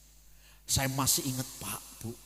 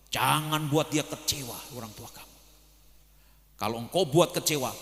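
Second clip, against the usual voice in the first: forceful preaching; 5 dB louder than usual. Real, not acted. Preacher warning children not to hurt their parents, forceful and insistent. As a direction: plead firmly, leaning hard on the warning.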